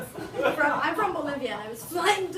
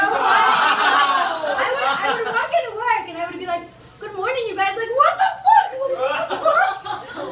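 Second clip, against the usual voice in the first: shrieking, laughing what the fuck; laughing disbelief; 9 semitones higher, 11 dB louder, slower than usual. Retold or imitated. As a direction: act out shocked laughing outrage. Acting out coworkers' shocked reaction.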